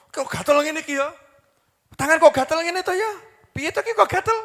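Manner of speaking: high-pitched, exaggerated puzzled whine; mock bewilderment. Preacher mimicking someone fretting over an itchy hand, mock bewilderment. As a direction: fret over it in a high, put-on voice.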